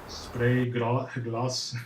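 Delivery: laughing stumble on "gloss" in fake French accent; stumbling over GLOSS. Real, not acted. Tutorial host trips on a hard word, laughing stumble on "gloss". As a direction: break off at "glo-", laugh, then "gloss". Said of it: "that's a hard word to say".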